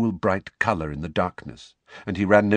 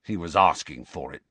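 understated, slightly lower snarl; surly self-justification, not raised; 3 semitones lower than usual. A narrator's reading. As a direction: snarl your excuse. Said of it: "snarled Dudley".

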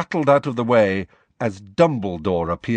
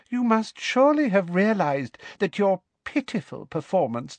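higher, prissy and condescending; Umbridge's sweet cruelty; 10 semitones higher than usual. Acted. Inquisitor gloating at the sacked teacher, high and condescending. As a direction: lecture her with sugary contempt.

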